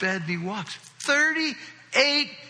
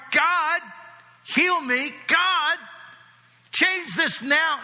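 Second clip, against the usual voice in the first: bold commanding prayer; 8 semitones higher, much slower than usual. Retold or imitated. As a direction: pray like you expect it done now. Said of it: "direct, faith-filled, authoritative prayer".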